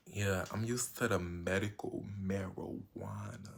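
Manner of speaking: slow whispered aside; hushed, slow whisper. Real, not acted. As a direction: whisper it like a secret confession. Whispered aside about weed.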